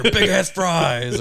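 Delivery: a hammed-up fast food jingle voice; mock ad announcer. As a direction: stretch it out like a goofy commercial.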